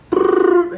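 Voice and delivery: loud high brrr like a ringing phone; phone ring imitation. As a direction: make the phone ring with your lips.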